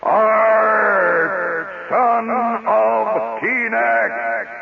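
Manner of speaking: high, very drawn-out echoing cry; sing-shout the hero's title into a cave; hollered show opening. Retold or imitated. Host imitates the radio show's opening, long high holler. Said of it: "He hollered the name of the character".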